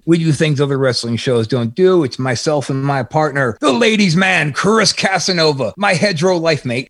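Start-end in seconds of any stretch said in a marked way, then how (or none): 3.6-6.9 high ring-announcer build-up; hype intro. Real, not acted. Podcaster introducing his partner Chris Casanova, hyped and high. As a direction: sell him like a wrestling star.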